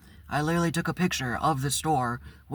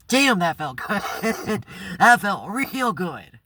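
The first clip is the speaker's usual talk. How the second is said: slow and laughing, higher and louder; laughing relief; 5 semitones higher, 5 dB louder, much slower than usual. Real, not acted. Adam home after quitting, laughing with real relief. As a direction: savour it through a real laugh.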